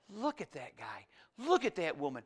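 mock disapproving murmur; mock disapproval. Preacher voicing what God never says, hushed mock disapproval. Acting out God judging people.

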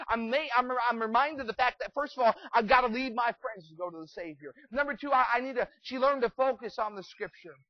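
high, loud pulpit delivery; full-force preaching. Acted. Preaches as grieving Martha.

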